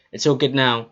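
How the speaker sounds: usual speaking voice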